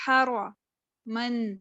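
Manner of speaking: حرمن very slowly, heavy را, thin میم; slow Quranic reading. Shown as a demonstration. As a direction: stretch out حرمن syllable by syllable. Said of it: "میم جو ہے باریک ہے". (حرمن آہستہ، میم باریک)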